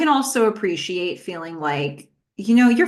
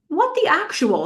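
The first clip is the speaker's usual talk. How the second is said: sharply higher, disbelieving cry; shocked disbelief; 10 semitones higher than usual. Acted. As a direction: caught off guard by what she sees. Finds Billy snorting cocaine.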